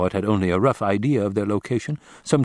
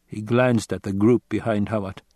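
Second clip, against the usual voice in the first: ordinary delivery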